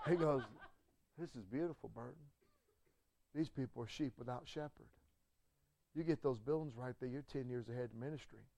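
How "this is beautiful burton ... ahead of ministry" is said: soft, low, weeping Southern preacher; an emotional country pastor, much softer; tearful country voice. Retold or imitated. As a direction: speak it quietly through tears. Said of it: "he starts crying".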